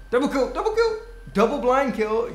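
high, loud triumphant call; excited cheer. Real, not acted. Gamer cheering his double kill. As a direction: celebrate the kill.

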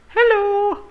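very high, silly greeting; squeaky hello. Pretend. Greeting a hand.

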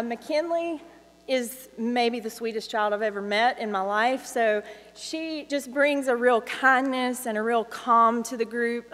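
ordinary talk, nothing marked

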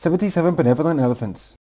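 rattled off at top speed; very fast. Shown as a demonstration. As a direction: blur the tongue twister together. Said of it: "Say the tongue twister faster and faster and faster".

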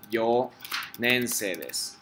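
"Yonense desu" very slowly, yo then nense; slow pronunciation demo; slow correct form. Shown as a demonstration. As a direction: model "Yonense desu" at half speed. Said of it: "Solo esta pronunciación cambia".

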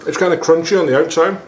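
words around food in mouth; eating between sentences; talking while chewing. Real, not acted. Seen 0: holds bitten pastry, jaw working between words, bites again after.

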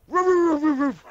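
high, loud rapid woofs; excited pretend doggy barks; dog barking. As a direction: bark eagerly like a little dog, spelt-out ruffs. Player role-plays an excited dog barking.

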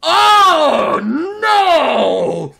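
loud, drawn-out cry of dread; dismayed wail. Real, not acted. Dreads the character's blunder.

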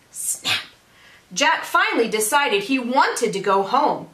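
usual speaking voice

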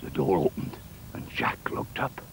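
low, hushed storytelling; hushed suspense. A narrator's reading. Narrator as the door opens on Jack, hushed. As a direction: drop right down for the suspense.

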